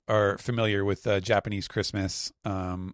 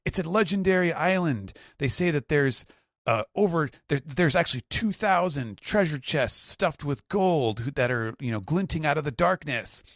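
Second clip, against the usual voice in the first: higher, hushed-legend telling; storytelling Nami; 7 semitones higher than usual. Retold or imitated. Nami describing the island, higher dramatic voice. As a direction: tell a treasure legend. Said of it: "Nami is like".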